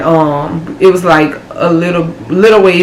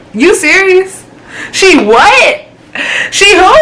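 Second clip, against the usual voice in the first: high-pitched, incredulous outburst; shocked disbelief; 12 semitones higher, slower than usual. Retold or imitated. Woman confronting boyfriend over pregnancy news, incredulous. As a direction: voice shoots way up in stunned questioning.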